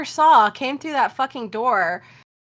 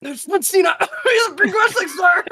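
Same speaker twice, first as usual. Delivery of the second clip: excited bystander; 6 semitones higher, 4 dB louder than usual. Retold or imitated. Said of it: "somebody next to me was like".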